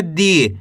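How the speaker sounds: long ee in दी, falling; drawn-out syllable. Shown as a demonstration. Teacher showing how दा becomes दी, vowel drawn out. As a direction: stretch the vowel of दी. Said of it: "खींच के इसका उच्चारण करना है".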